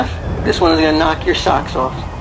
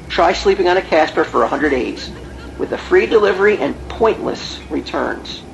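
ad read; 3 semitones lower than usual. Acted. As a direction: sell it like a radio spot. Reads Casper ad copy.

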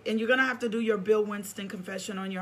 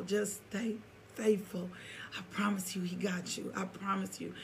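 whisper; 8 dB quieter than usual. Real, not acted. Pastor whispering encouragement to a woman of God. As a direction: lean in and whisper the promise. Urging listener, voice drops to whisper.

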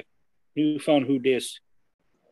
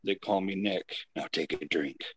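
low mysterious voice; 4 semitones lower, 6 dB quieter than usual. Acted. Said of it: "the voice spoke".

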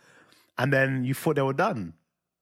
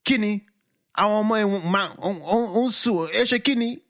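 hammed-up uncle scolding his wife; indignant uncle in Yoruba; 8 semitones higher than usual. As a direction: demand answers in mock outrage. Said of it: "voices uncle in Yoruba".

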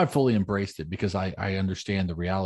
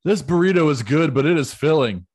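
louder, higher quoting of a burrito line; movie quote; 6 semitones higher, 8 dB louder than usual. Retold or imitated. Quoting a movie at a Mexican restaurant, louder and higher. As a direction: declare it louder, a bit higher. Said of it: "at some point say".

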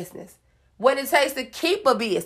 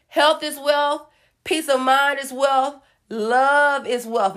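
slow affirmations; slower than usual. Real, not acted.

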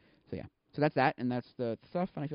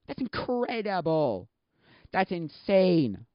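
slow, high-pitched disbelief; amazed; 5 semitones higher, 3 dB louder, much slower than usual. Real, not acted. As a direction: drag out each word in amazement. Amazed at editors' rates.

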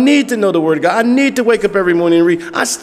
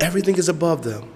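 ordinary talk

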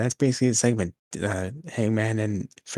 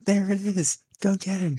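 very high, eager hunter cry; excited; 11 semitones higher than usual. Acted. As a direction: squeal it with excitement. Hunter spots the deer.

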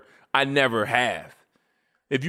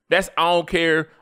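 ordinary talk, nothing marked

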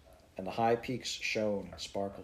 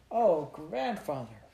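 slow, higher, eager call; girl greeting her grandfather excitedly; joyful cry; 6 semitones higher, slower than usual. A narrator's reading. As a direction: run to him delighted. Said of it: "Heidi cried".

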